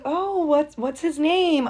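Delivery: mock interested mom. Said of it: "I'm like".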